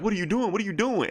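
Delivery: repeated panicked question; alarmed girl. Retold or imitated. Girl watching Groot engulf the Gardener, alarmed and high. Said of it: "And the girl like".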